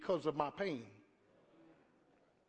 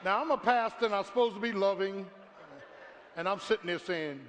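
usual speaking voice

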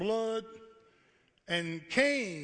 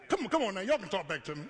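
normal talking voice

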